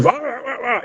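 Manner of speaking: high, soft cartoon snarl; hammed-up creature noises; mock growling. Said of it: "he starts going".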